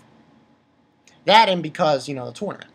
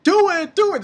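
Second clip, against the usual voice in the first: mock urging; 12 semitones higher, 5 dB louder than usual. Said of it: "and he's like".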